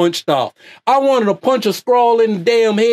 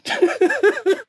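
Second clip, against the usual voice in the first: high burst of laughter; 13 semitones higher than usual. Real, not acted. Laughs at own parody.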